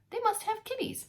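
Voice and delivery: a hint of a curious little girl; naive girl voice. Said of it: "she said".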